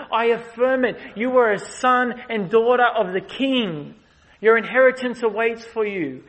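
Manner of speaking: Jesus proclaims forgiveness to the weeping woman; emphatic blessing. Acted. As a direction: declare it over her with force.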